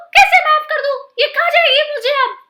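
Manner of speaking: frantic high cry of fear; panicked. Acted. Monkey terrified the crocodile's wife will eat him, panicked. Monkey fears being eaten.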